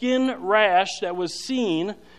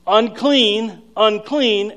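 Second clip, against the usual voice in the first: mild mock yell, louder and drawn out; imitated leper's call, raised but controlled; retold yelling; 3 semitones higher, 6 dB louder, much slower than usual. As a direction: call out a warning, half full force. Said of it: "He's yelling".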